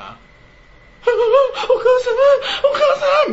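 slow, high, loud hammed-up sobbing; slow, high fake crying for mother; mock sobbing. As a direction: wail "お母さん" like an overdone mourner. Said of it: "みたいな人はちょっと本当に無理".

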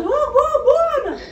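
high, loud imitated outburst; mock exclamation. Woman in labour reacting to Ana, acted-out high outburst.

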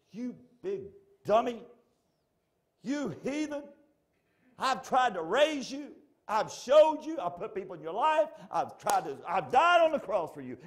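high, loud exasperated rant; mad Jesus. Acted. Imagined Jesus scolding his people, angry high shouting. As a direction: shout it like a fed-up parent, pitch soaring. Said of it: "What if he got so mad?".

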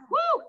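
short, high, loud woo; exertion whoop. Real, not acted. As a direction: whoop as the set finishes. Cheering end of exercise set.

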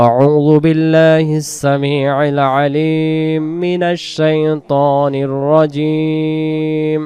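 measured, drawn-out chant of the isti'adha; slow Quran recitation. Acted. Teacher opening the lesson with a slow recitation.

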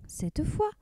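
plain reading voice

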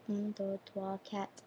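tiny under-the-breath count; counting to oneself. Real, not acted. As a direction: softly run through the numbers. Counting up to find the word.

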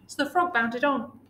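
ordinary delivery, nothing marked

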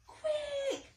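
high, loud urgent wobbly voice; urgent high warning call; wibbly wobbly voice. Acted. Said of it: "a wibbly wobbly voice said".

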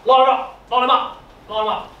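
German officer yelling to line them up, fading; Gestapo order shout. Retold or imitated. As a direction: shout it at the prisoners, then let it drop. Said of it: "The Gestapo said".

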